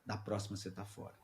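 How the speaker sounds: quiet, low threat from the boss; a hint of menace, dropped low; low stern warning. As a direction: boss muttering a final warning in the car.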